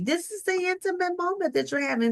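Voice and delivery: high, quick rush of excitement; bursting with feeling; excited. Real, not acted. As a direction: lift it up fast with joy. Excited preaching about intimacy.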